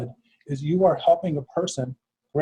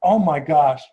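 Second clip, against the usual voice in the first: real astonished outburst, louder and higher; freaking out; 5 semitones higher, 6 dB louder, slower than usual. Real, not acted. Host interrupts guest on hearing a familiar name, freaking out. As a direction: blurt it out in stunned amazement. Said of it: "freak out?".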